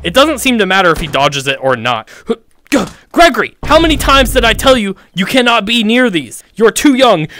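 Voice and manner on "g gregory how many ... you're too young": higher, exasperated telling-off; playful parental lecture; mock scolding parent.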